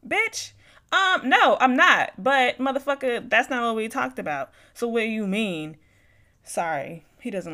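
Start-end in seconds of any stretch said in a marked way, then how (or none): 0.1-5.8 much higher, much louder angry outburst; angry rant. Real, not acted. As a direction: let real anger burst out at him. Present-day rant at ex.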